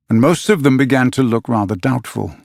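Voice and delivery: plain reading voice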